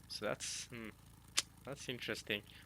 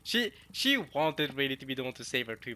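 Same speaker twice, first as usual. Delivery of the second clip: ordinary delivery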